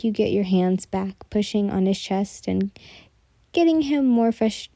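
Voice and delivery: usual speaking voice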